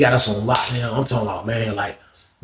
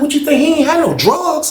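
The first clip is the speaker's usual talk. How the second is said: much higher, incredulous protest; high-pitched disbelief; 14 semitones higher than usual. Retold or imitated. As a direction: protest that it can't be true. Arguing friend was clean.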